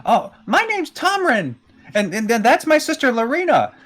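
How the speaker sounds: put-on high, loud child voice, slowed down; eager little boy. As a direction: introduce yourself like an excited kid. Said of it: "the little boy".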